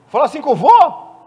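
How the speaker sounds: offended relative shouting about grandpa; outraged shout. Acted.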